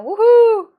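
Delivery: loud, high woohoo; celebratory whoop. Pretend. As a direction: cheer the award winner. Cheering the mock award.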